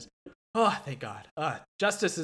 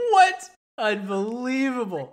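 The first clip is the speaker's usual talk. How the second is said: high, loud shocked outburst; 8 semitones higher, 7 dB louder, slower than usual. Real, not acted. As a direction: blurt it in disbelief. Shock at the vote trade.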